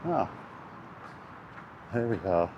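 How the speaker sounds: normal talking voice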